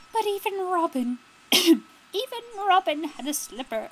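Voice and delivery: put-on voice, pitched high and a little loud; strained high voice for one of the outlaws; hard character voice. Game player voicing one of Robin's men, put-on high voice. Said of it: "his voice is so hard".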